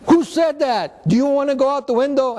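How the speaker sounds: high-pitched indignant demand; mock angry challenge. Teacher confronts the heckler, high mock-angry voice.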